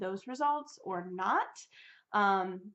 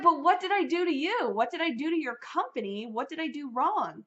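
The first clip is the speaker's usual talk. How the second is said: high, louder, hurt plea; a wounded appeal, voice raised and high; pleading; 7 semitones higher, 4 dB louder than usual. Retold or imitated. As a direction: beg to know what she did wrong. Retold plea to billing rep.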